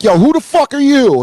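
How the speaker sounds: high-pitched indignant challenge; acted incredulous demand; mock confrontation. Acted player challenging an intruder.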